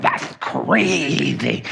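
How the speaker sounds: slow, drawn-out "That's crazy" with a rising end; all-in. Retold or imitated. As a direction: throw yourself into it, dragging it out. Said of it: "he gets his whole body shaking into it".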